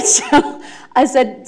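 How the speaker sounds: normal talking voice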